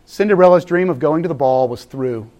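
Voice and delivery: normal talking voice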